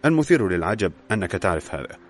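usual speaking voice

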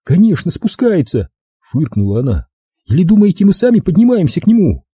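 high-pitched sarcastic retort; high, derisive comeback; scornful captive woman. A narrator's reading.

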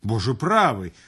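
ordinary delivery

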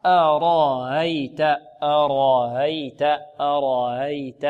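slow careful أرأيت; modelled recitation. Shown as a demonstration. Teacher modelling the word for students. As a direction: repeat the word slowly. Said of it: "الهمزة الأولى مرققة والثانية الراء مفخمة".

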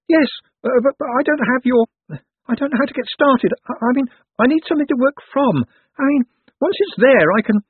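very high, stammering character voice; flustered nervous little man. A narrator's reading. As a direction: stammer excuses in a high, anxious pitch. Flustered Owlswick explains himself.

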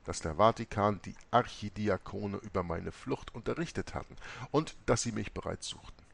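ordinary delivery

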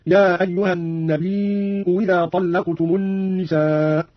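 النبي with long ī and hamza, إذا begun as "wi-dha"; slow chant, saying إذا as "widha" after النبي; hamza turned to waw. Shown as a demonstration. Teacher showing the substitution, إذا starting with a waw sound. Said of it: "ووجه الإبدال هكذا".

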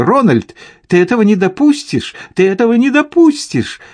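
high, insistent, agitated cry; shrieky demand, repeated with mounting pitch; frantic protest. A narrator's reading. Wife to husband as the riders approach, frantic protest.

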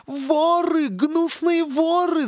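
high, slow screeching palm tree; wailing tree. Acted. Palm tree wailing at the coconut thieves, high and shrill. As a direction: screech like a furious crazed palm. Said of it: "завопило дерево".

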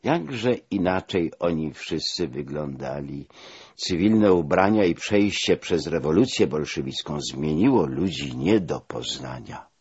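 usual speaking voice

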